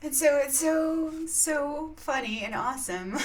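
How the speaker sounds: usual speaking voice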